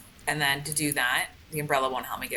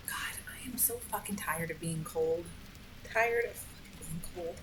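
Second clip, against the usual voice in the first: slow, weary whisper; frustrated whisper; 6 dB quieter, slower than usual. Real, not acted. Gamer whispering his frustration about the cold.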